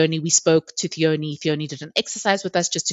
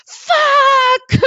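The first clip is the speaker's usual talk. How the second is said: very high, loud, drawn-out yell; retold scream; 7 dB louder than usual. Mother re-enacts screaming as she pushes, high loud yell. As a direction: scream it long as if pushing. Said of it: "I just kept on screaming".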